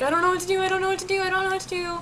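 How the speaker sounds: high, whiny helpless panic; mock frantic wailing; imitating crying. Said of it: "I'm, like, crying".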